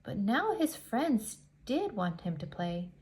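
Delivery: ordinary delivery, nothing marked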